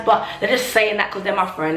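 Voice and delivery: ordinary talk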